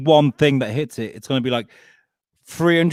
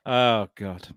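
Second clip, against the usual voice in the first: normal talking voice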